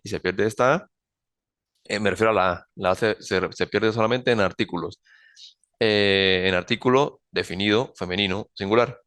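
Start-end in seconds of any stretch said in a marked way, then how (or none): none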